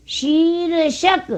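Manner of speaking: शीर्षक slow and high, long shee then r; r after शी. Shown as a demonstration. Teacher showing where the r falls, drawn-out शीर्षक. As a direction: stretch it as shee-r-shak in शीर्षक. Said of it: "शी के बाद र बोला है".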